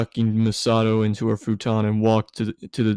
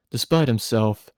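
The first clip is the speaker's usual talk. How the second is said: ordinary delivery, nothing marked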